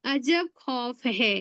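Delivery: mnemonic line spelled out slowly; dictating a memory line slowly; slow recital. Shown as a demonstration. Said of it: "एक line याद कर लेना".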